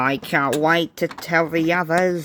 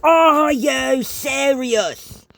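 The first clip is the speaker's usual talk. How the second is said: much higher, slow outraged question; fit of rage; 11 semitones higher, 3 dB louder, much slower than usual. A narrator's reading. James hears his job, raging. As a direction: explode in disbelief. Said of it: "in a fit of rage".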